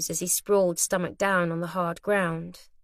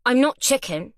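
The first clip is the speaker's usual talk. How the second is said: growled; 5 semitones higher, 5 dB louder than usual. A narrator's reading. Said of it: "Justin growled".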